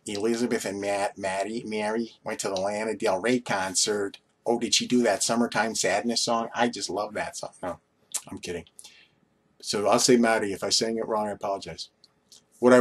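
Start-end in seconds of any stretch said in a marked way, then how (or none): 0.0-7.5 hammed-up Long Island, New York accent. Shown as a demonstration. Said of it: "say it in my Long Island accent".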